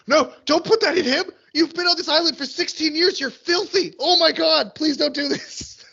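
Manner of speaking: very high-pitched distressed plea; horrified pleading. Retold or imitated. Viewer retelling protest at a dirty needle, horrified pleading. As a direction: beg in squirming, high-voiced horror. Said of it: "I was just like".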